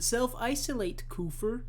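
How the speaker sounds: high, mocking jeer. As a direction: sneer it in a squeaky voice. Continues the mocking voice.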